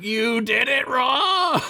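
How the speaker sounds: high, slow hammed-up whine; mock whiny yell. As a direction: squeal it like a scolded child. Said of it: "He's like".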